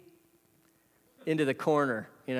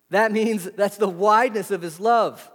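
usual speaking voice

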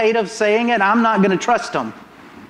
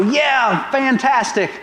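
a lightly higher mock celebration; mock cheer; 7 semitones higher, much slower than usual. Preacher mocking a celebration with the crowd, light cheer. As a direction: cheer it, half teasing. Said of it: "Do we go".

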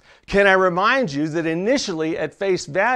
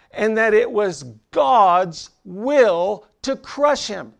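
builds louder and higher through the list; rising preaching; 4 semitones higher, 3 dB louder than usual. Acted. Preacher lays out the suffering to the congregation, building. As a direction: preach the suffering with mounting force.

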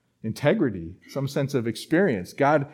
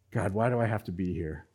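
low, soft plea to God; quiet lament; 8 semitones lower, 10 dB quieter than usual. Retold or imitated. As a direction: ask it wearily, almost to yourself. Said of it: "wrestling back and forth with God".

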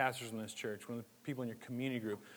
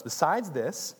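higher, louder slow reading; preaching the verse; 6 semitones higher, 7 dB louder, slower than usual. Acted. Pastor reading scripture to the congregation, forceful. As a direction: proclaim it to the church.

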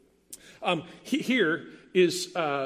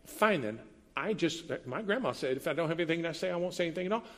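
sulky retort; 7 dB quieter, faster than usual. Retold or imitated. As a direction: sulk it out quietly. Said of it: "Or maybe it sounds like".

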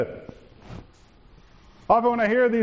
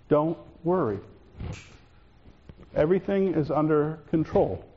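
lower, soft, steady "Don't worry" and "Everything is under control"; calm, clear voice; 3 semitones lower, 3 dB quieter than usual. Shown as a demonstration. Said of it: "said in a calm, clear voice".

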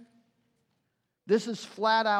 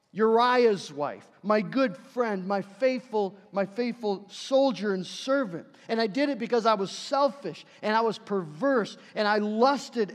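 impassioned, loud confession; David confessing; 3 semitones higher, 6 dB louder than usual. Acted. King David confessing to God that he stole a wife, impassioned. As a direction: own the wrong forcefully, hammering the key words. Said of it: "This is David coming to God and saying".

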